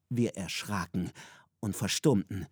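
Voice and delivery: plain reading voice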